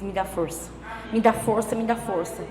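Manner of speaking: a tired, hushed plea to God; soft pleading prayer. Retold or imitated. (súplica baixinha e cansada)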